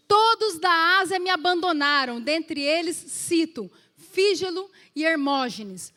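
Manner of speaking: slow, loud, ringing scripture read; solemn preacher reading. Acted. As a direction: proclaim the verse to the church.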